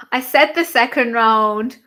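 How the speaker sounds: loud breathless i-called-it; gasp, then crow about the prediction; excited gasp. Real, not acted. Viewer reminding chat she called the round, excited and loud. Gasping after her prediction.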